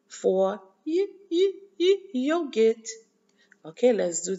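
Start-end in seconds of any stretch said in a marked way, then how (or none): 1.3-2.9 high, short "yuh" for each "y", then "yogurt"; quick high y sounds leading into the full "yogurt"; repeat-after-me phonics. Shown as a demonstration. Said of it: "répétez".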